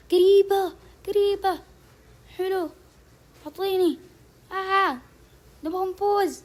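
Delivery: high, loud near-miss cry; real excited yelp over a near miss; excited shout. Real, not acted. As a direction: yell that it was so close.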